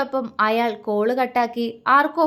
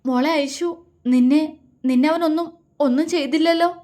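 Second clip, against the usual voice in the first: higher, halting worried voice; anxious, broken-up phrases pitched up; fearful question; 5 semitones higher than usual. A narrator's reading. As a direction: ask haltingly, dreading the answer. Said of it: "Angel പേടിയോടെ ചോദിച്ചു".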